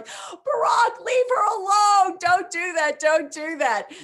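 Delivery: high, loud scolding shout; mock yell. Wife scolding her husband, high loud shout. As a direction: yell at him to stop teasing. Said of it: "[name]'s yelling".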